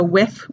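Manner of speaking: "with" as wiff. Shown as a demonstration. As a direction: finish "with" on an F. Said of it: "comes out as an F".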